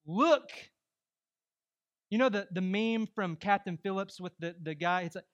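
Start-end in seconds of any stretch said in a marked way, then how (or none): 0.0-0.5 loud, high one-word command; forceful pulpit exclamation; emphatic. Real, not acted. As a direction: punch it out like a preacher. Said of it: "you need to be emphatic too".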